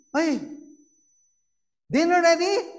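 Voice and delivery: loud, high mock bark at the wife; loud husband. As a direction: demand dinner like a rough husband. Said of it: "his voice is loud".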